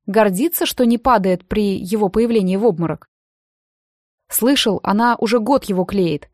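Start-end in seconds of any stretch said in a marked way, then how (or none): none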